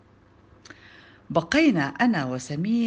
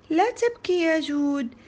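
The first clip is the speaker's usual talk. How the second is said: high-pitched soothing teacher; consoling teacher; 9 semitones higher than usual. A narrator's reading. Teacher telling crying Joud not to cry, consoling. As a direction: comfort a child in a lifted voice.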